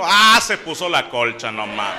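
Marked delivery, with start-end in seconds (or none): 0.0-0.4 sudden loud yelp of fright; startled scream. Acted. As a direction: yelp in terror. Scream at the coco.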